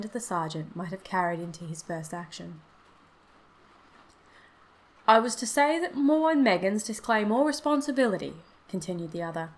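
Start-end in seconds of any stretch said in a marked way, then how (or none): 5.1-8.4 higher, emphatic official message; formal statement. A narrator's reading. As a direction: deliver the company's disclaimer formally. Visitor formally delivers the firm's message.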